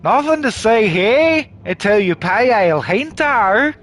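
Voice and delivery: hammed-up Australian guard; badly faked broad Australian; bad Australian accent. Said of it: "I'm burning my worst accents".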